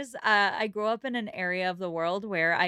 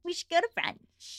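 1 mock Mrs Elton; 10 semitones higher than usual. Mocking Mrs Elton's plans.

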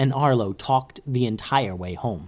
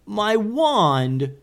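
high, drawn-out lament; dismayed wizard's cry; 9 semitones higher than usual. A narrator's reading. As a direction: cry out high over a loss. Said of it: "yelled one of the wizards".

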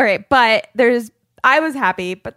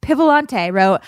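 ordinary talk

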